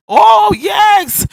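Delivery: high and loud, a burst of emphasis; excited exclamation. Real, not acted. Preacher exclaiming to congregation.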